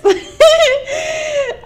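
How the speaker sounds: high laugh bursting out; burst of laughter. Real, not acted. Laughs at own impression.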